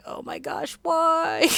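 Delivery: whispered, then a high squeak; alien face dismay. Pretend. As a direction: voice the clothing's silent horror. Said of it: "it's just like".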